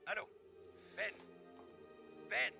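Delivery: put-on old phone recording. Recorded phone call on cassette.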